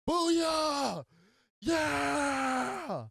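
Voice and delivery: very high, loud yelled booyah; wild restaurant cheer; retold scream. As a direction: belt it across the restaurant. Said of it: "we hear this man scream out".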